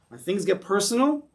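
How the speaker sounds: normal talking voice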